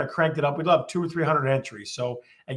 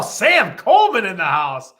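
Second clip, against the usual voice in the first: loud hype call welcoming a friend; hype shout-out; 2 semitones higher, 9 dB louder than usual. Acted. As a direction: shout it out like an MC spotting a regular. Hyping a chat guest's arrival.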